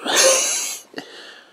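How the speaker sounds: very high breathless giggle; high, airy villain giggle; shrieking giggle. Acted. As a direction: squeal with mad delight. Villain laugh.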